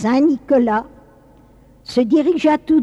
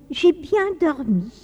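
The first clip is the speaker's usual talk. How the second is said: put-on light, higher little-child voice; waking child; 5 semitones higher than usual. First child brought back to life, childlike. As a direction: speak as a small child just woken. Revived child speaks in tale.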